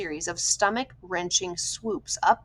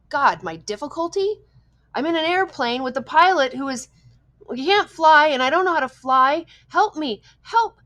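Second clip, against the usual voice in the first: high, loud, frightened pleading; desperate plea; 7 semitones higher, 9 dB louder than usual. A narrator's reading. Frantic boy begs the radio voice for help, high and loud. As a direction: beg for help, voice climbing in fear.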